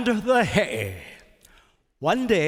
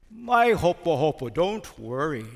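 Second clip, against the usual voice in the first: ordinary talk, nothing marked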